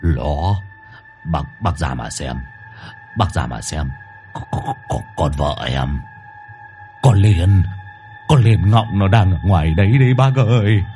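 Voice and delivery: low stutter breaking into alarm; trembling, panicked stammer. A narrator's reading. Husband warns that his wife is outside, panicked stammering. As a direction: stumble over words, then burst out with the name. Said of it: "Long gật đầu run run".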